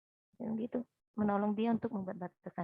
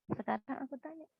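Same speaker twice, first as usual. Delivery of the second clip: ordinary delivery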